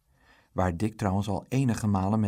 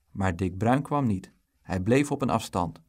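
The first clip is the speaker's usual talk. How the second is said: ordinary delivery, nothing marked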